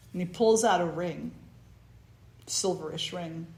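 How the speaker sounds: ordinary delivery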